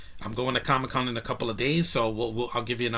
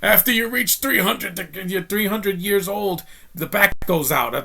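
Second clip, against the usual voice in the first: put-on slow, much higher old-man voice; Grandpa Munster; 7 semitones higher, 4 dB louder, slower than usual. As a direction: croak it out like a creaky ancient vampire grandpa. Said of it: "He's like".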